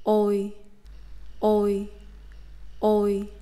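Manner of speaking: "Oi" from rounded o gliding to e; Vietnamese diphthong ôi. Shown as a demonstration. Teacher drilling ôi for learners, careful and clear. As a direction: repeat "oi" with rounded start three times. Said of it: "by rounding your lips like this".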